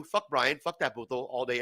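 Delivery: usual speaking voice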